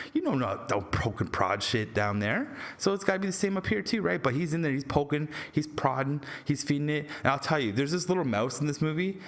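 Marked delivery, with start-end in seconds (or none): none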